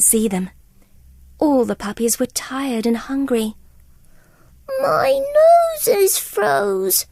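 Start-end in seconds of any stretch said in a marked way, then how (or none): 4.8-7.1 high, slow, whiny little pup; tiny pup's sad complaint; whimpering puppy. Acted. Lucky to his parents, high slow whimper. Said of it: "whimpered Lucky".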